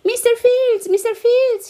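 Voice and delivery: running and calling his name. A narrator's reading. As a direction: call across the farmyard. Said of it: "he called as he ran".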